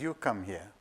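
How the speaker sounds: low, soft, calm summons; a hushed divine voice; voice of the Lord. Retold or imitated. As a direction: call him gently from far off. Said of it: "He says".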